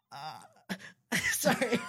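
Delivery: ordinary delivery, nothing marked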